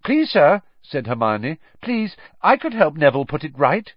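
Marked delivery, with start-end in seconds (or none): 0.1-0.6 high, eager, pleading plea; pleading schoolgirl. A narrator's reading. Hermione begging Snape to let her help, high eager plea. 1.8-3.9 high, eager, pleading plea; pleading schoolgirl. A narrator's reading. Hermione begging Snape to let her help, high eager plea.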